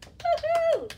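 very high, loud whoop; cheer. Real, not acted. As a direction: whoop for joy. Cheering after the song.

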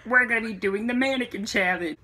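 mock vlogger intro for a puppet; puppet's voice. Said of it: "I'll do the voice for you".

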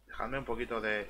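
usual speaking voice

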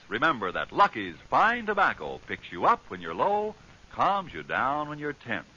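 usual speaking voice

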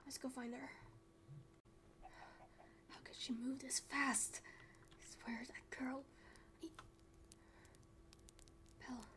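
hushed frustrated whisper. Acted. Chasing runaway girl through dark woods, whispered. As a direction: mutter it under your breath.

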